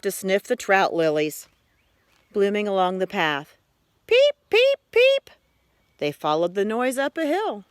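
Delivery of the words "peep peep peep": very high, chirpy little peeps; frog peeping. A narrator's reading. Little frog calling through the woods, high chirpy peeps. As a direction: pipe it like a tiny spring frog.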